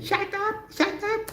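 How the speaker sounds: very high mock shrieking shut up; imitating a student yelling. Teacher retelling his Taiwan student, high mock yelling. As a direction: shriek it like an overexcited pupil. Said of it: "it end up saying".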